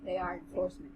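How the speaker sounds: measured, even audiobook read; story narration. A narrator's reading. Narrator reading a fantasy novel to the co-host, story narration. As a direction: read the tale aloud with composure.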